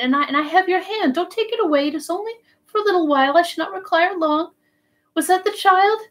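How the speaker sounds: high, fervent pleading of a dying man; broken, spirited delirium. A narrator's reading. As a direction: ramble tenderly to a beloved, in fits and starts. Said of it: "talking brokenly but with spirit".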